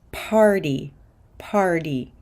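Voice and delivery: "party" said like "pardy"; fast D for T. Shown as a demonstration. Teacher models American "party" with a fast D. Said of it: "to a fast D sound".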